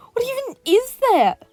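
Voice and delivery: very high, loud startled yell; villain yelling. A narrator's reading. Villain yelling at the roaring lion, very high and loud. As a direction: shriek it in alarm. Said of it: "the hand villain yelled".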